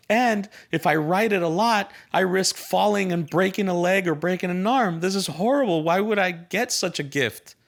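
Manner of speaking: put-on high, loud whining child, listing his woes; whiny pessimist kid. Pessimist boy whining to his parents about the bike. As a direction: play the fretful kid who hates his gift.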